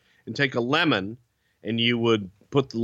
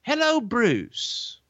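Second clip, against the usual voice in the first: Alfred impression; 7 semitones higher than usual. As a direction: impersonate a polite Englishman, pitched way up. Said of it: "I get a phone call".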